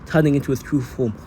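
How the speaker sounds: plain reading voice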